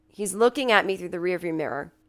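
ordinary talk, nothing marked

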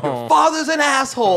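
high, loud mock shriek; retold parental fury. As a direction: hurl it back as the other parent, high and loud. Retold parents' bitter fight after breakup.

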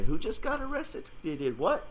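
half-joking startled viewer; mock bewildered reader. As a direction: sound lost reading the ticker. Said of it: "that's rolling off and say".

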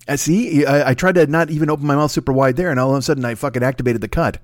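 ordinary talk

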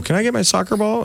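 high-pitched imitated kid asking; child's request. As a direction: mimic yourself as a kid, asking for something back. Child asking neighbor for ball back.

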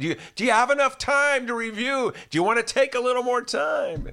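mock Biden offering extra time; Joe Biden impression. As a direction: coddle the nominee. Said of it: "'cause he would be so nice".

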